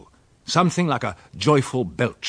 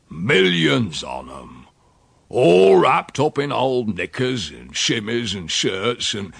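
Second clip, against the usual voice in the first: slow, weighty, louder country dialect; rustic old uncle, marvelling; 4 dB louder, slower than usual. Acted. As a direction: stretch the tall tale out with relish.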